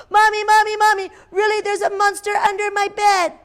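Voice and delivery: put-on high, urgent child's cry for Mommy; scared child calling. A narrator's reading. Child calling Mommy from bed, high and urgent. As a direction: call out like a frightened little kid.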